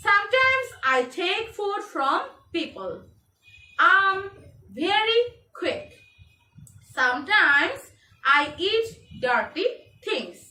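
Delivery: slow, spaced-out reading aloud; model reading for learners. Shown as a demonstration. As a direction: read slowly, phrase by phrase, for pupils to follow. Reads lesson text for pupils.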